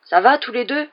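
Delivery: high, loud narrated call; gruff shout. A narrator's reading. Goyle-bodied Harry calls to his friends in the cabins, shouting. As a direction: call out loudly as a big-voiced boy. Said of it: "cria d'une grosse voix rauque".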